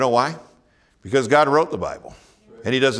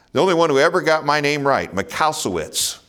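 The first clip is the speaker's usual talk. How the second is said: jokey outburst; 5 semitones higher, 4 dB louder than usual. Real, not acted. Preacher joking loudly about his name.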